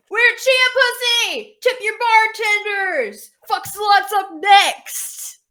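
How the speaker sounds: loud, high, drawn-out band frontperson yell; stage shout. Acted. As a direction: belt it out to the whole bar between songs.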